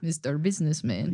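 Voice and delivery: put-on a fuller Russian accent on a repeat of the line; a thicker Russian voice with a rising end on Businessman. Friend repeats the show quote in a fuller Russian accent. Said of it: "Russian is also the only accent".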